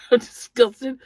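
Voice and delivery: loud, high mock disgust. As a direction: spit out disgust playfully. Mock disgust after nose joke.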